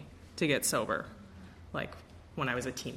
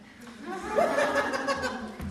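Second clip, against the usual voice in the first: squealing laugh. Retold or imitated. Sponsor's thrilled squeal continues.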